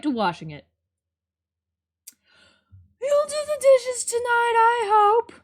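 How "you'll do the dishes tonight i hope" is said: mom voice. Acted. Voicing the mom character.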